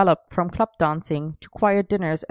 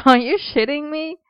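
half laughed; 9 semitones higher than usual. A narrator's reading. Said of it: "Merlin half laughed".

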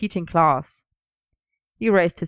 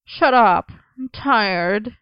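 whiny; 4 semitones higher, 3 dB louder, much slower than usual. Acted. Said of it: "kicked at him pitifully".

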